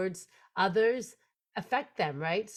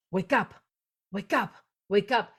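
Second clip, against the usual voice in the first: louder, insistent repeated call; urgent wake-up call; 5 dB louder than usual. Retold or imitated. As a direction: imitate a voice calling someone awake. Retelling urgent voice waking her.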